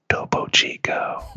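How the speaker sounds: breathy hushed naming of a treasured drink; reverent whisper. Pretend. Comedian reveres his fancy mineral water in a whisper. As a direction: whisper it like something sacred.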